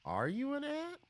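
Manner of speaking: startled question. Real, not acted. Meeting a strange ant.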